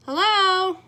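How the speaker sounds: put-on high, loud, bright call of a little girl; girl calling hello. Girl spots Beekle in the tree, calling up high and loud. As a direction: call up to him like a curious child.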